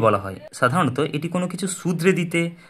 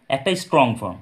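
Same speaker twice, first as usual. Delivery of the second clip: ordinary talk, nothing marked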